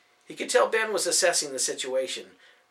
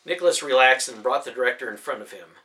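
plain reading voice

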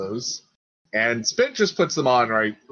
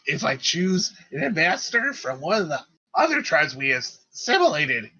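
high-pitched, halting character voice; small creature working out a plan; eager little leader; 7 semitones higher, 3 dB louder than usual. Acted. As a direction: think aloud up high, warming to the idea. GM plays Spit thinking aloud.

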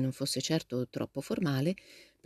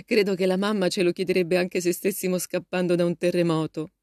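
said on the tail of a laugh; amused, laughing; 4 dB louder than usual. A narrator's reading. Jo joking about mamma to her sister, laughing. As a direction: let the laugh colour the joke. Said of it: "aggiunse con una risata".

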